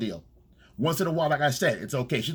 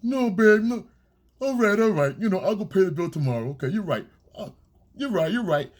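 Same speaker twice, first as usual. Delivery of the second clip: higher, louder pleading, giving in; mock caving boyfriend; 7 semitones higher, 3 dB louder than usual. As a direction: cave in to her, raised and pleading. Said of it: "you fucking go".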